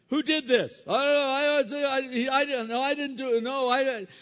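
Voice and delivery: put-on high, loud squabbling children denying blame; high, loud chorus of defensive kids; bickering kids. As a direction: play a pack of kids all shouting it wasn't me.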